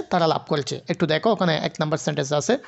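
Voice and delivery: normal talking voice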